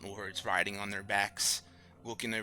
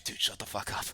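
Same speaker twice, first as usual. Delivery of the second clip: unvoiced mutter; 4 dB quieter than usual. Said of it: "you're just, like, staring at Avgar".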